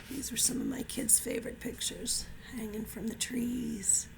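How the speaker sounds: soft whispered aside. Real, not acted. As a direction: whisper it like a secret. Aside to kids, hushed.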